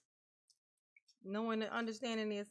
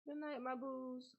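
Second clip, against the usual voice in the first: soft slow goodbye; 3 semitones higher, 6 dB quieter, slower than usual. Real, not acted. Zacht afscheid.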